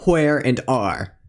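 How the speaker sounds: ordinary talk, nothing marked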